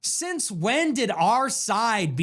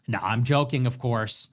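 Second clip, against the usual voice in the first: usual speaking voice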